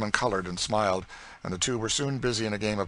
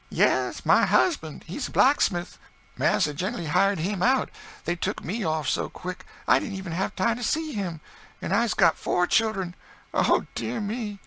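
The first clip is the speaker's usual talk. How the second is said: grieving enslaved woman; 13 semitones higher, 6 dB louder than usual. Acted. Enslaved woman telling Emmeline about her old master, high and mournful. Woman lamenting lost family.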